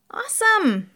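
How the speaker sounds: high, loud burst of excitement; excited. A narrator's reading. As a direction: jump up high with enthusiasm. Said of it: "says Jerry, excited".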